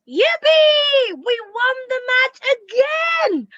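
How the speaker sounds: high, loud cheering yell; excited shout. Shown as a demonstration. Teacher acting a joyful win, shouted high and loud. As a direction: shout it like the team just won. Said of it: "showing expression, a great emotion".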